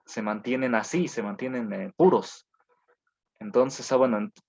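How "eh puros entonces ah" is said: ordinary delivery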